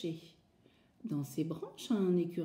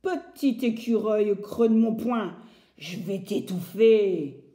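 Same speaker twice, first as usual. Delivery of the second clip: menacing woodcutter; 7 dB louder than usual. Acted. Bûcheron menace l'écureuil.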